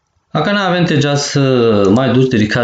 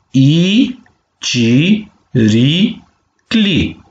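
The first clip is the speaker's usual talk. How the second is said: ci, ri, cli with a gap after each; syllable by syllable; 3 semitones higher, much slower than usual. Shown as a demonstration. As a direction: model ci - ri - cli for repeating, each syllable held. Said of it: "penem palalman de vitumen". (pe silabe: ci, ri, cli)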